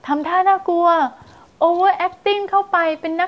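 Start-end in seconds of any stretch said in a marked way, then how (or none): none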